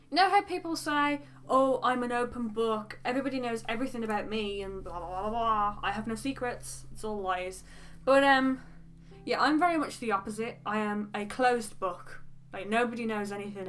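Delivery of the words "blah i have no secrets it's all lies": ordinary talk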